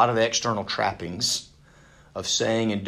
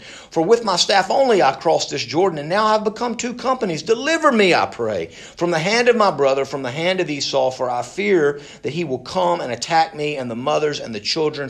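raised, emphatic plea from scripture; declaimed prayer; 5 semitones higher, 5 dB louder than usual. Acted. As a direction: plead aloud to God for deliverance. Reads Jacob's prayer aloud.

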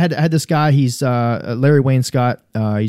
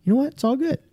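mock cheerful reassurance; 12 semitones higher, 4 dB quieter than usual. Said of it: "How do you take that person and go".

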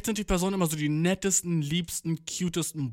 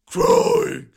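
scream attempt; 11 dB louder than usual.

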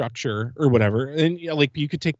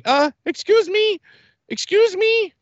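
much higher, slower put-on voice of a stern ride attendant; imitating the lady; slower than usual. Recounting how the attendant at the ride booth called him back, mimicked high and slow. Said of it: "This is how she sounded".

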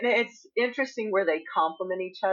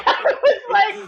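laughing; 14 semitones higher, 9 dB louder than usual. Real, not acted. Laughing while retelling.

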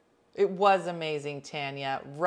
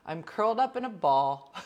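ordinary delivery

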